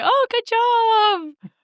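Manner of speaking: very high, long-drawn congratulation; hammed-up high praise; mock parental approval. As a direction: imitate impressed parents in a stretched-out squeal. Parents' impressed reaction, acted out.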